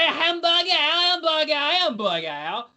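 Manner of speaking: put-on hamburger, said high, with a French accent; a Pink Panther style French hamburger; mock French hamburger. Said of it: "Steve Martin can do it in the Pink Panther".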